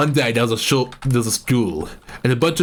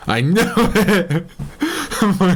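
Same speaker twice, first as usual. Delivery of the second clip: laughing; 6 semitones higher, slower than usual. Acted. High laughing character voice.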